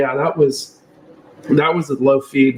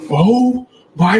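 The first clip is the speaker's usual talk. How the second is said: mock alarm; 8 semitones higher than usual. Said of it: "he just started freaking out".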